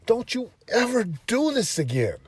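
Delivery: high, loud stern warning to son; stern scolding. Retold or imitated. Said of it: "I'm like".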